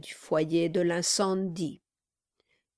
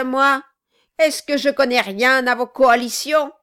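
loud, scornful, accusing; furious dwarf; 3 semitones higher, 9 dB louder than usual. Acted. Quilp rounds on Brass in a loud scornful rage. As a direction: spit the questions back.

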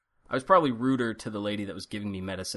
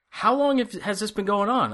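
mock-alarmed, much higher pitch; alarmed nurse; 9 semitones higher than usual. Nurse questions patient after seeing the bad ear, retold alarm. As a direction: imitate a startled nurse asking an urgent question.

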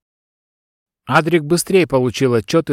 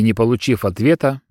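ordinary delivery, nothing marked

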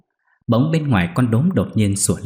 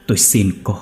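begging. Acted. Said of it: "cầu xin".

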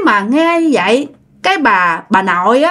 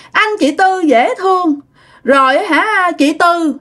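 put-on high little-child voice; toddler voice; 7 semitones higher, slower than usual. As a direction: praise them like a sweet small kid. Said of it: "giống như đứa con nít".

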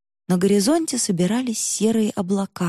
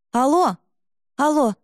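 calling out; 6 semitones higher, 3 dB louder than usual. Acted. Calling into phone line.

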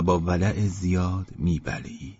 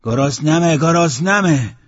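loud, high cry with drawn-out ending; demanding yell for food; hungry shout; 8 semitones higher, 8 dB louder, slower than usual. Acted. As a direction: holler it, stretching the last word. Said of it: "داد زد".

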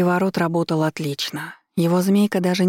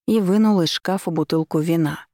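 plain reading voice

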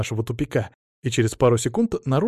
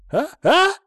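sharp high gasped "А? А?"; gasping in shock; 4 dB louder than usual. A narrator's reading. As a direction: gasp it out, much higher and louder. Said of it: "ахнул Адамыч".

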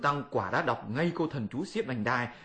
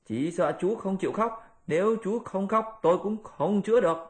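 high, firm monkey voice; high Monkey King, teasing demand; Monkey King insisting; 7 semitones higher than usual. Acted. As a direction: push the pig to cry. Monkey insists on Pigsy crying.

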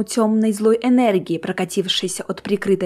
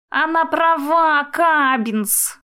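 slow, higher-pitched moan; groaning; 7 semitones higher, much slower than usual. A narrator's reading. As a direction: groan the words out, drawn long. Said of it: "простонал Кипс". (протяжный стон на повышенном тоне)

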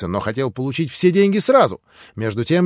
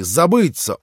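ordinary delivery, nothing marked

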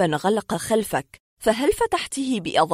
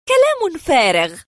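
high, loud, slow dismissal; scornful woman; 9 semitones higher, 7 dB louder than usual. Acted. Patient dismisses the psychiatrist's theory, indignant. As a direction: throw it back indignantly, word by word.

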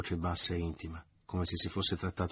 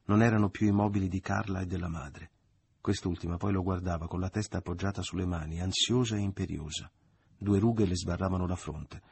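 ordinary delivery, nothing marked

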